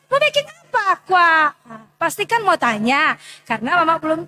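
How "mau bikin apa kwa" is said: put-on child asking. Child answers mother's call.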